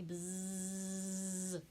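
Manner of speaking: a drawn-out buzz like a bee. Shown as a demonstration. Teacher buzzing along with the class like a bee. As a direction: buzz the word out. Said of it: "Can you buzz with me?".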